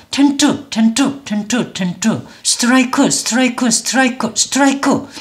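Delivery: rapid repeats keeping the extra "eu" vowels; sped-up Korean-style. Shown as a demonstration. Teacher proves speed changes nothing, quick Korean-style repeats. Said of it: "속도를 높여서 빠르게 발음해도".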